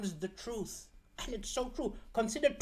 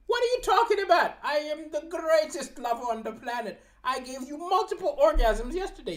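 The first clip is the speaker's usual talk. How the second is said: high, fast, louder outraged protest; indignant denial; 7 semitones higher, 3 dB louder than usual. Acted. Boyfriend answering his upset girlfriend, high indignant protest. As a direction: scoff at the accusation, pitched way up.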